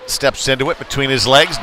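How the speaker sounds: normal talking voice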